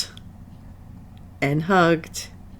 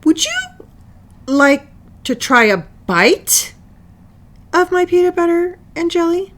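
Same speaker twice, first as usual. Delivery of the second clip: timid offer; 11 semitones higher than usual. Acted. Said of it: "got up the courage to speak".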